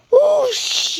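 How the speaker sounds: high, loud rushing whoosh; steam whoosh. A narrator's reading. Gordon slipping off the rails, loud steam whoosh. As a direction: blast it like escaping steam. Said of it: "he hissed".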